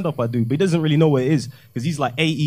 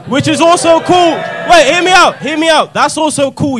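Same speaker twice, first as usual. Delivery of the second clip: loud insistence; 10 semitones higher, 8 dB louder than usual. Real, not acted. Defending his claim to audience.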